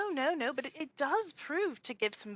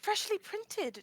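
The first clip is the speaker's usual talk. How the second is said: sly excuse; 8 semitones higher, slower than usual. Acted. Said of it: "why does it look so funny?".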